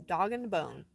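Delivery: normal talking voice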